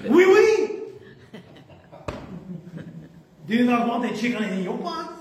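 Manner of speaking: hammed-up French king addressing his crowd; mock French accent. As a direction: play it comically French. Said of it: "So, no French accent".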